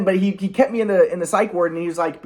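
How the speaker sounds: ordinary delivery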